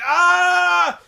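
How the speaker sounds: loud high held "aah" of agony; long hammed-up yell of pain; mock pain scream. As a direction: imitate a wrestler howling in an armbar. Imitating man cranked again.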